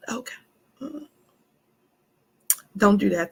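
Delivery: ordinary talk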